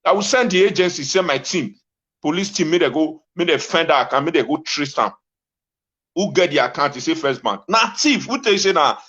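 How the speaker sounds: ordinary talk, nothing marked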